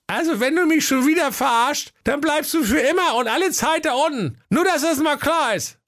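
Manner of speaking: squeaky prince, shrewd and demanding; a sly little prince laying down terms; high squeaky prince. Acted. Hacke Peter threatens. (piepsiger, gewitzter Prinz)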